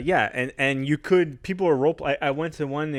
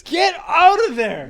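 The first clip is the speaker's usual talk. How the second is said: high, slow, loud call; shouting; 6 dB louder, slower than usual. Real, not acted. Podcaster calling to the bird by the window, shouting. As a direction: yell it out, stretching the words.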